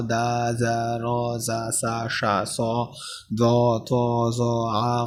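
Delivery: each letter sound like দা and জা softly drawn out into a long a; soft drawn-out letter drill; stretched syllables. Shown as a demonstration. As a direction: hold every vowel a little longer, relaxed. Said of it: "একটু টানতে হবে".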